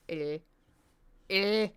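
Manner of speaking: "eh" as a try at the Korean L sound; attempted Korean sound. Shown as a demonstration. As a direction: say "eh" tongue back, between R and L. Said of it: "I can't pronounce their".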